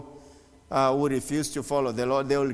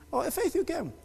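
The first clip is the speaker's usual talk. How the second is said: surprised greeting; 12 semitones higher, 4 dB quieter than usual. Real, not acted. Greeting latecomer in church.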